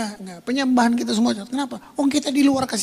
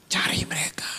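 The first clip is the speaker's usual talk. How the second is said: unvoiced, soft and low; gentle whispered urging; whispered command; 4 semitones lower, 8 dB quieter than usual. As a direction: send them out in a hush. Jesus voiced, hushed.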